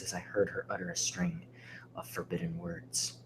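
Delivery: low, hushed horror narration; ominous story narration. Acted. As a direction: draw them in softly, pitched well down. Reads a micropasta solemnly.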